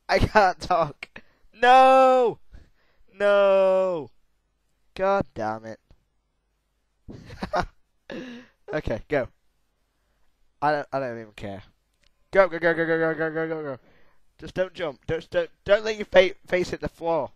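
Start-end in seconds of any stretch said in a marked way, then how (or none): 1.5-4.1 high, loud cry of no; anguished shout at the screen; despairing shout. Real, not acted. Character fails, outcry. 12.3-13.8 rapid loud go chant, fading; cheering go go go, dying down; excited urging. Real, not acted.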